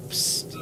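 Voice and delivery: soft hissing psst; breathy ghost whisper. Acted.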